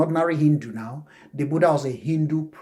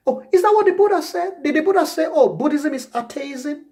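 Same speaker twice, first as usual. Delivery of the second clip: high, louder polite query; nice correction; 11 semitones higher, 3 dB louder than usual. Acted. Answering someone who calls Buddhism atheism, nicely high-pitched query. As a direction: question them nicely in a raised pitch. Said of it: "You nicely kind of correct it".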